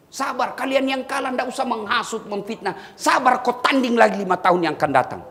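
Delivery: loud fiery sermon voice; forceful preaching. Acted. Preacher urging election losers to accept defeat, loud and fiery. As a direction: preach it hard to the whole crowd.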